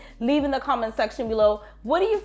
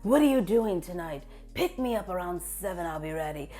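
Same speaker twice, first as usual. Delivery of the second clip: lower, softer sultry seductress; low soft come-hither purr, fading quieter; seductive lady voice; 5 semitones lower, 6 dB quieter than usual. Shown as a demonstration. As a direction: invite him out like a confident femme fatale. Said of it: "this lady speaks like this".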